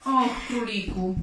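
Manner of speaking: slow, drawn-out sigh of reproach; lightly acted dismayed moan. A narrator's reading. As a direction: groan it gently to a friend. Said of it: "jęknęła kangurzyca".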